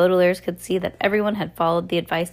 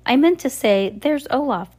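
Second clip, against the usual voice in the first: hurried; 7 semitones higher, 3 dB louder than usual. A narrator's reading. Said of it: "hurriedly pushing Jerome".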